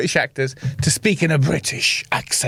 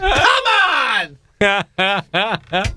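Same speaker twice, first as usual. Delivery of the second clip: mock-anguished cry of protest; hammed-up exasperated plea; exasperated wail; 3 semitones higher than usual. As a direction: wail it out in comic despair. Imagined bald hairy man's exasperation.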